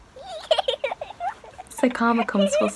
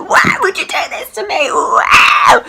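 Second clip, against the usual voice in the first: much higher, louder crying wail; loud sobbing outcry; crying shout; 12 semitones higher, 6 dB louder than usual. Retold or imitated. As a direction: wail it through tears. Breaking down in bedroom.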